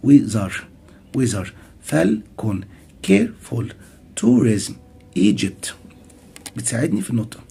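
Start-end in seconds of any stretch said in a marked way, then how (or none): 0.0-5.7 "ويزر، فالكون، كيرفول، توريزم، ايجيبت" each punched on its first syllable; stress on first syllable. Shown as a demonstration. Teacher demonstrating two-syllable stress, first syllable stressed. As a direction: hit the opening syllable of "فالكون" and each word in turn. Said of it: "في المقطع الأول".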